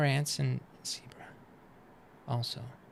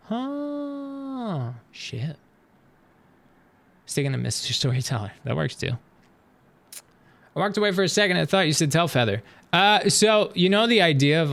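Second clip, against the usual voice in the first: ordinary delivery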